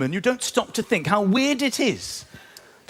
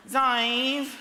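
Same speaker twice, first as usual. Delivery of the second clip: whiny tourist; 3 semitones higher, 4 dB quieter, much slower than usual. Retold or imitated. Whiny British tourist on holiday.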